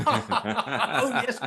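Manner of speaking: high, hearty mock guffaw round the words; mock gushing laughter. Overhearing the rival's interview through the wall, mock gushing laughter. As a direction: imitate an audition going brilliantly, all laughs. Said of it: "hear it going brilliantly".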